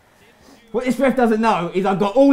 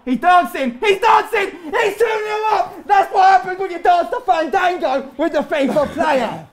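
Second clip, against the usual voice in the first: high, jubilant crowing; excited high-pitched boast; gloating goal shout; 8 semitones higher than usual. Real, not acted. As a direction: shout it in triumph at your opponent.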